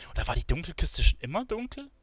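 ordinary talk, nothing marked

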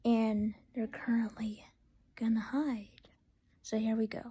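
hushed whisper while seeking. Real, not acted. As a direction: whisper so they can't hear. Seeker whispers while hiders hide.

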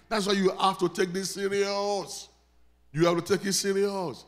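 usual speaking voice

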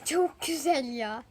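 "çok güzel ya" drawn out very slowly; slowly, dreamily amazed; slow savoring. Real, not acted. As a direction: stretch each word of "çok güzel ya" in awe. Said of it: "repeats it slowly".

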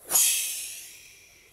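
unvoiced rushing whoosh of the sleigh; breathy whoosh. Sleigh takes off, breathy rushing whoosh. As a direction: blow a loud gust of air.

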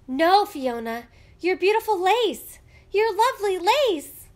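loud wail climbing very high; hysterical scream. A narrator's reading. Girl watching her sister cut lace, screaming hysterically. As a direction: build from protest to shrieking hysteria. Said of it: "screamed as she became hysterical".

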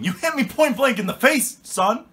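loud high outraged cry; outraged yell. Acted. Dad yelling at son after being hit.

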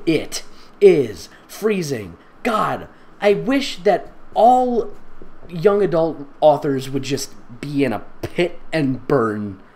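drawn-out hammed-up misery; slow mock lament. Reader hamming the freezing girl's slow complaint. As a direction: stretch each word like a tragic heroine.

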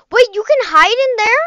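ordinary delivery

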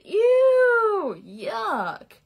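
high loud grossed-out cry; disgusted. Acted. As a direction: squeal it like it's gross. Rats react to clothes.

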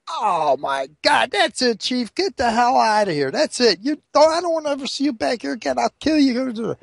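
high, fed-up yelling at the customer; mock-furious barman; exasperated throw-out. The saloon keeper throws the Chief out, high and exasperated.

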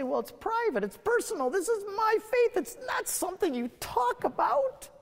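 a hint of a reticent believer brushing the topic off. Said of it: "Catholics have a tendency to say".

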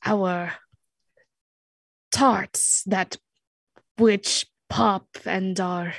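slow, halting, pompous challenge; fumbling would-be leader; self-appointed leader. Acted. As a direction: declaim it grandly while losing the thread. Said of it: "presents himself as the leader of the group".

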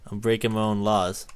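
ordinary talk

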